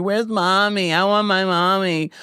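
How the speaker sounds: high-pitched mock whine of a little girl; crying little girl. Host mimicking a poor little girl crying for mommy, high mock whine. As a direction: wail like a small child, put on. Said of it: "your poor little girl's crying for you".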